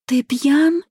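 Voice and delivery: high-pitched deflecting question; flustered. A narrator's reading. Bride trying to shift blame, flustered high question. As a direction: throw the blame back, voice lifted high. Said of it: "сбивчиво произнесла".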